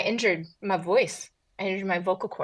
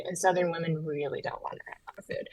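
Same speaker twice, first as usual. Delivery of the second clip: whispering; 2 semitones lower, 5 dB quieter than usual. Pretend. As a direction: whisper it like a secret. Southern women, the hidden-rage side.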